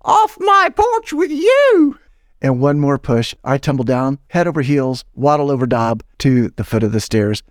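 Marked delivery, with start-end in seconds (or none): 0.0-2.0 very high, slow, drawn-out shriek; shrieking landlady. Acted. Landlady drives the salesman off her porch, slow high screech. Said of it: "She doesn't even think twice".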